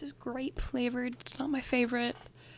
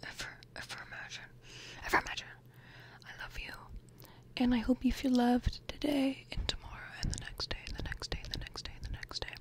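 tender whisper; 5 dB quieter, faster than usual. Real, not acted. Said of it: "tender whispered sign-off".

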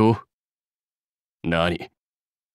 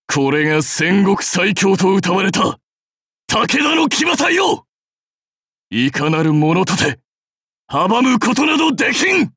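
battlefield boast; 3 semitones higher than usual. Acted. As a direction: proclaim it, climbing to a shout. (誇らしく高らかに叫ぶ)

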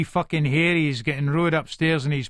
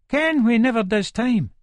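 emphatic; 9 semitones higher, 5 dB louder than usual. Acted. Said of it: "Nelly's grim joke".

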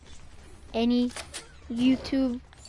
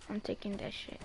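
much softer, low muttered swear; muttered curse; 4 semitones lower, 13 dB quieter than usual. Real, not acted. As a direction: slip out "shit" under your breath. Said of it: "I mean, I'm taking that poop".

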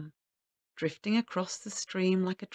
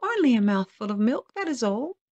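high little magic man; raised, thin pleading voice; clear, thin voice; 4 semitones higher, 6 dB louder than usual. A narrator's reading. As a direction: call up a small request, clearly. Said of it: "thin voice from below".

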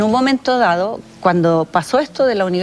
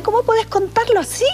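high, incredulous friend's reproach; shocked disbelief; 13 semitones higher than usual. Retold or imitated. Friend scolds her in disbelief.

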